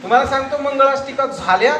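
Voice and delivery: chuckling while telling; laughing. Real, not acted. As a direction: speak through a laugh. Laughing at own wedding story. (हसत हसत)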